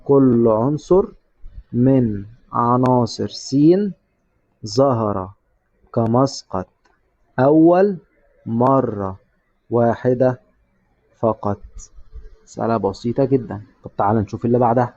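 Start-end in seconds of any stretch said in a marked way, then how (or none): 0.1-11.6 very slow, word by word, for writing down; like a teacher dictating the answer line; slow dictation. Shown as a demonstration. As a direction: dictate each word with pauses so students copy it. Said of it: "وتشرح لي".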